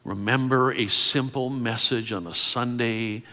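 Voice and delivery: ordinary delivery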